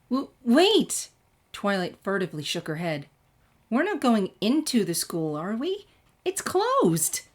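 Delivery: high, loud, rising panic; alarmed. A narrator's reading. Twilight panicking that they are entering the closed school. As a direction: yelp in worried protest.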